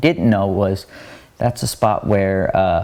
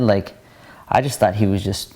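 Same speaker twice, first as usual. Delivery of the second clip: ordinary talk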